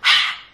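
voiceless exhaled ha; breathy throat exhale, demonstrated; Arabic ha sound. Shown as a demonstration. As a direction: push air out with no vocal cords. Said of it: "it's just pushing air outside of your mouth".

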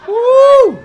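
high, loud whoop; sudden shrill woo. Real, not acted. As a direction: whoop like a hype man. Cheering at the crowd.

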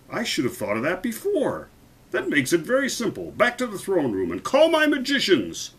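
high-pitched, rising to a royal command; decisive king. A narrator's reading. King sending for his magicians, decisive and commanding. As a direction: build from realization to an order.

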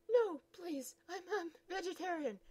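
high, loud whimpering plea; panicked pleading. Acted. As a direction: beg not to go in.

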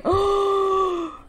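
long, high gasp; drawn-out startled breath; frightened gasp. Real, not acted. Frightened underwater.

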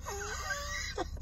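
high squealing burst of laughter; shrieking laugh. Real, not acted. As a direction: let a shrill laugh burst out.

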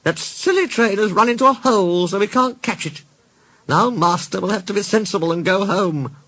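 high-pitched fussy car voice; high storybook car, relieved; Caroline the car, gleeful. A narrator's reading. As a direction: crow over it in a high put-on voice.

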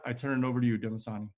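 ordinary delivery

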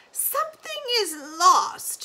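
put-on slow, high, vague old woman; old granny voice. Granny explaining to Lina, slow and high. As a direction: drift off like a confused grandmother.

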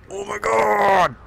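long, high, loud cry; exasperated shout. Real, not acted. Gamer fed up with the game's rules, exasperated shout.

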